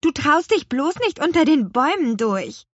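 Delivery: high, loud taunt; scornful snort. A narrator's reading. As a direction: needle the brother for being scared. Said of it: "schnaubte Lea". (spöttisch und laut)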